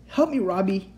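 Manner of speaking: high call for help. A narrator's reading. Tied-up child to the boy, calling for help. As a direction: call across the room urgently. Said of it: "I called".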